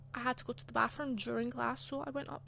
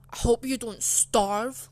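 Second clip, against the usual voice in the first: hostile, nasty; 11 dB louder, slower than usual. Retold or imitated. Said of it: "in a pure hostile, nasty way".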